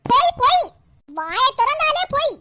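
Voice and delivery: staged shout. Acted. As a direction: shout her down as a pretend tyrant. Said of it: "எல்லாம் நடிப்பு". (நடிப்பாக உரத்த கத்தல்)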